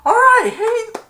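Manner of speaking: high, loud cheer for Heenan. Retold or imitated. Team cheering Heenan's touchdown, high and loud. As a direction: whoop it out like a fan. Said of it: "they went".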